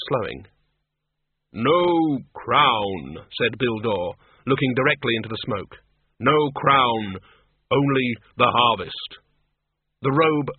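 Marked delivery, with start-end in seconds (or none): 1.5-3.3 slow, grave and hollow; measured, heavy disapproval. Acted. 6.2-9.2 slow, grave and hollow; measured, heavy disapproval. Acted.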